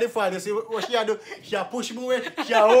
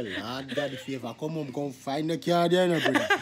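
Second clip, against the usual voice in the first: quiet prayer; 9 semitones lower, 6 dB quieter than usual. Retold or imitated. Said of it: "me I say".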